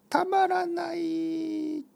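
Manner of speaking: very high, drawn-out squeal of delight; mock-ecstatic falsetto cry. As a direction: stretch it into a hammed-up fan's squeal. Acts out core fans' delight.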